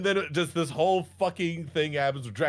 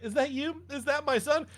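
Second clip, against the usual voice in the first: hopeful mother; 9 semitones higher than usual. Retold or imitated.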